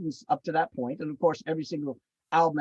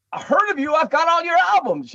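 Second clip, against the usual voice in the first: higher and louder, gushing; excited fan; 10 semitones higher, 5 dB louder than usual. Retold or imitated. Young drummer gushing to his hero on the phone, high and excited. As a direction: blurt it out like a thrilled fan. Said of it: "I go".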